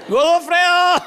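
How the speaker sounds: high, loud call; playful yell. Real, not acted. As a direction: shout the name across the room. Calling out playfully.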